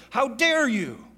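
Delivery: loud, high-pitched indignation; retold anger. Father to son who broke his arm, indignant. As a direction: burst out with mock outrage. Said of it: "In my anger, I said".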